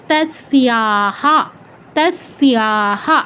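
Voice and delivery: "তস্যাহা" as tas-siyaa-haa, very slow; full final h. Shown as a demonstration. Teacher modelling for students, "তস্যাহা" as slow tas-siyaa-haa. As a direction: draw out each syllable of "তস্যাহা", rising at the end. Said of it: "তাহলে উচ্চারণটা কী হবে".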